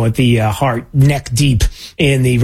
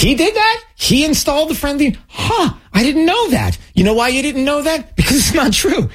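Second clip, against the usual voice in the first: sarcastic disbelief; 11 semitones higher than usual. Acted. Sarcastic reply to senator's claim.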